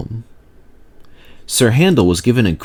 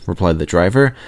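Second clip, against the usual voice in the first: ordinary delivery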